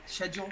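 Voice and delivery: "schedule" starting with a soft sh; British sh at the start of "schedule". Retold or imitated. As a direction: say "schedule" as "shed-jool", British style. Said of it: "find humor in, like, uh, British".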